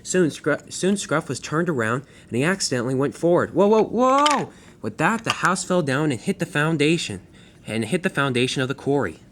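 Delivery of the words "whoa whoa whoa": high, loud alarmed cries. Acted. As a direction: yelp as it slides away. Engine rolling out of control.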